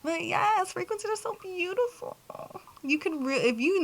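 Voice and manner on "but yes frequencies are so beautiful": rapt gush. Real, not acted. Rapt high tone.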